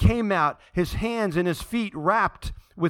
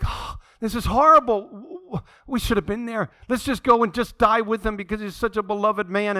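higher, distressed lament; acted dismay; 6 semitones higher, 4 dB louder than usual. Disciples hear that Lazarus has died, acted dismay. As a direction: react with raised, pained disbelief.

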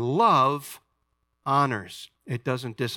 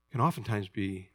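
ordinary talk, nothing marked

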